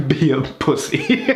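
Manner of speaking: drawn-out and giggly; laughing punch line. Real, not acted. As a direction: squeeze the joke out while cracking up. Cracks up at cats joke.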